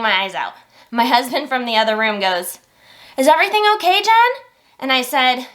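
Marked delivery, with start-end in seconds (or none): 3.2-4.3 louder, higher call across the house. Retold or imitated. Husband calling to wife from the other room, raised voice. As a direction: call through to the next room.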